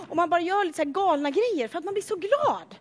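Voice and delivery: normal talking voice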